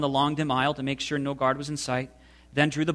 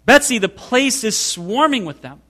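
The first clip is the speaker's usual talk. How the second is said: higher and louder, still crying out in dismay; alarmed; 7 semitones higher, 7 dB louder than usual. A narrator's reading. Corey warning her sister about the fleas, alarmed and loud. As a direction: keep the panic going as you tell her. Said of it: "I cried".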